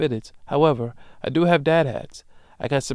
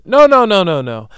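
loud, high, flat refusal; big, loud no-no-no; emphatic no; 6 semitones higher, 11 dB louder than usual. Real, not acted. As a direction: shout the no down hard. Refusing to give his hoodie.

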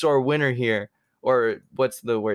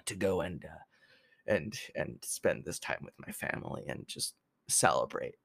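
whispering; 6 semitones lower, 10 dB quieter than usual. Real, not acted. Trailing into whisper.

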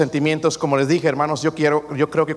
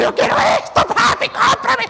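put-on shrill, much higher, shouted kid demand; child yelling; 6 dB louder, slower than usual. Spoiled child yelling at dad in Walmart, shrieking. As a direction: scream it like a spoiled kid in the store. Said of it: "Y empiezan a gritar".

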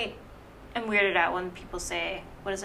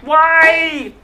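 high, drawn-out wail; loud protest; 7 semitones higher, 11 dB louder than usual. Real, not acted. Teased friend asking why, wailing in protest. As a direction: cry it out, long and loud. Protesting while the other laughs.

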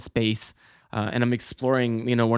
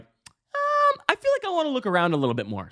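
very high, fast sing-song mock innocence; silly curious voice, rushed; playful whim; 14 semitones higher than usual. Retold dream, leaving safe zone.

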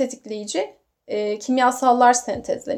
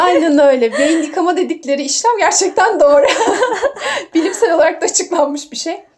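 laughing; 4 semitones higher, 5 dB louder than usual. Real, not acted. Laughing along at the joke.

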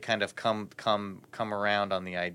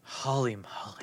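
slow whispered aside; 6 dB quieter than usual. Real, not acted. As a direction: breathe it out as a whisper. Whispered aside during talk.

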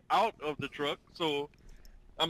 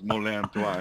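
imitating [name]; 4 semitones lower than usual. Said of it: "he got a line on one of them songs".

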